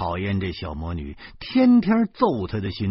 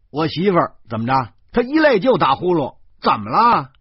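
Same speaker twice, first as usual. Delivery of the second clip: loud, high, flustered retort; 恼羞成怒地反问; embarrassed anger; 7 semitones higher, 9 dB louder than usual. Acted. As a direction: snap back, covering embarrassment. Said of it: "我恼羞成怒地把她推开".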